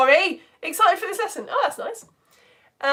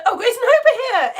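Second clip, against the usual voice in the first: much higher and louder, bubbling with laughter; excited voice; 12 semitones higher, 8 dB louder than usual. Real, not acted. Host greeting young viewers by name, excited and laughing. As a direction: greet them like they just made your day. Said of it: "I've reached peak excited voice with you".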